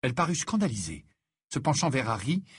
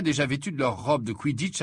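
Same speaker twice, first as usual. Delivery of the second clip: usual speaking voice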